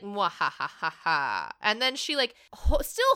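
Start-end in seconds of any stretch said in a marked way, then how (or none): 0.0-1.5 low, slow villain cackle; evil laugh. Retold or imitated. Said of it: "She's like".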